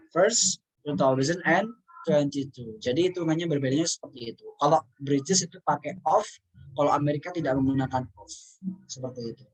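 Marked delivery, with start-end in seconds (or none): none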